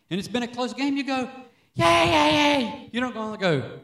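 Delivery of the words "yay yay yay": high, loud cheering for a touchdown; cheering yay. Shown as a demonstration. Fan at a close game, cheering loud. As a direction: cheer like your team just scored. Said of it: "address God in a loud tone and with a shout".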